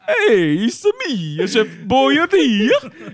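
high, slow, drawn-out cartoon chef voice; Chef Boyardee voice. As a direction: stretch out the name like a goofy Italian chef. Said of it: "Can we get, uh, Chef Boyardee on the phone?".

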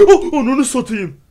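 gasp, then a high, loud, stretched-out yell of fright; jumped out of your skin; startled shout. Real, not acted. Gamer gets jump-scared by the boss, shouts in fright.

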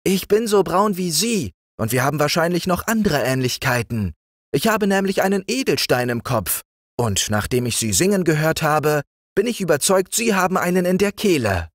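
put-on young king's voice. A narrator's reading. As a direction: play the young king granting an honour. King's flattering speech.

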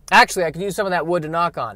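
normal talking voice

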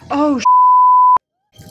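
sudden shriek of panic; startled scream. Real, not acted. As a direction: shriek in shock. Character catches fire, panics.

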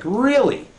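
sharply high, challenging "really?"; indignant disbelief. Retold or imitated. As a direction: throw it back as an incredulous challenge. Retold indignant reply to insult.

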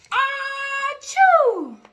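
high, loud sneeze sound; big achoo sneeze. Acted. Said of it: "before he could cover his snout".

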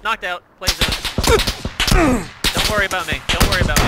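being chased. Real, not acted.

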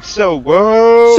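big whooping shout; gang call. Pretend. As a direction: holler the call out long. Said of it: "Somebody hear it one more time".

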